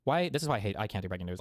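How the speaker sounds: usual speaking voice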